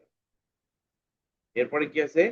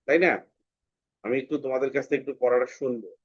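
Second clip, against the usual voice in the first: ordinary delivery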